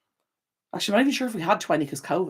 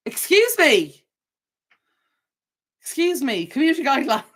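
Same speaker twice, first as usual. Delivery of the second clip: mock prim scold; 10 semitones higher, 6 dB louder than usual. Mocking a rule-citing commenter.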